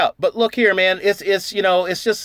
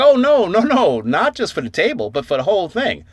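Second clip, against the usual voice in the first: correcting host; 7 semitones higher, 5 dB louder than usual. Retold or imitated. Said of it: "He's like".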